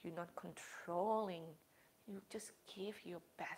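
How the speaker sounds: soft, hushed meditation voice with long pauses; guided meditation. Acted. Yoga teacher guiding the class through breathing, soft and hushed. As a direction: ease the class into calm breathing.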